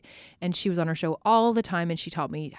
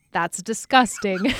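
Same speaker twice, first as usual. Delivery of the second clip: slow, louder, grossed-out; disgusted reaction; 3 semitones higher, 8 dB louder, slower than usual. Retold or imitated. Host gagging at tofu on live TV, slow disgust. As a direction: drag it out in disgust. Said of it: "I was like".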